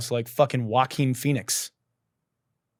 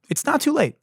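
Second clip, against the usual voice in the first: high pleading; 13 semitones higher than usual. Acted.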